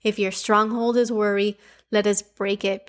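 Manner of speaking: ordinary talk, nothing marked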